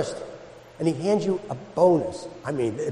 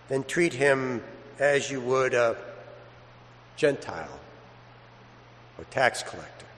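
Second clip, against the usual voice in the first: gospel reading; 4 semitones lower, 6 dB quieter than usual. Acted. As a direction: read it reverently, unhurried, to the congregation. Priest proclaims the Gospel.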